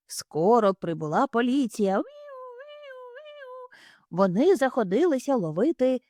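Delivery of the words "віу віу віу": very high, soft wailing siren; siren imitation. Narrator imitates arriving police siren.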